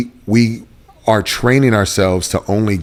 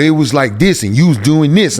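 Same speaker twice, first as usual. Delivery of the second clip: son mimicking dad's changed voice; lightly mock someone's altered way of talking; imitating different voice; 5 semitones higher than usual. Son shows dad how he sounded, lightly mimicking. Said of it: "your voice was different".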